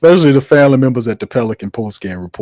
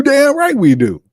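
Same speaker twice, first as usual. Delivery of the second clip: much higher, emphatic; defiant high cry; 4 dB louder than usual. Real, not acted. Host answering new viewers, high defiant exclaim. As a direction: shoot it back, high and defiant.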